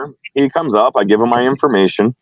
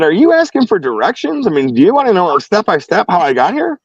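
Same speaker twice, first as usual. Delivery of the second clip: much higher, mock-helpful questioning; incredulous sarcasm; 8 semitones higher than usual. Driver asking officer if he wants directions, sarcastic incredulity. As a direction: pitch climbs high in exasperated disbelief.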